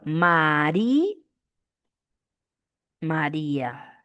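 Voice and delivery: "Mari-" stretched up to a held í, pause, then a slow low "María"; drawn-out syllables. Shown as a demonstration. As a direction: hang on the í of "Mari-", then finish "María" slowly. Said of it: "Separación y unión de vocales".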